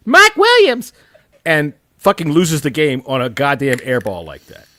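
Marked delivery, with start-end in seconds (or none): 0.1-0.9 exasperated outburst. Real, not acted.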